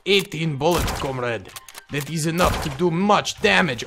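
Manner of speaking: put-on lightly done Russian accent, matter-of-fact; a hint of a Russian soldier counting ammo; Russian comrade accent. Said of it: "fun to do the Russian comrade accent".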